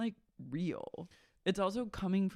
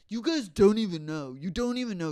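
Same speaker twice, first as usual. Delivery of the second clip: louder, heated mock rant; heated mock outburst; 3 semitones higher, 6 dB louder than usual. [name] lashing out at the cast, heated. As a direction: snap it at them.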